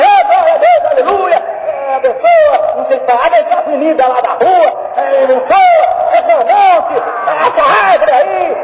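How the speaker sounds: exaggerated ecstatic prayer, laughing; mock flowery prayer. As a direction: parody the over-decorated pray-er. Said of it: "Não inventa oração".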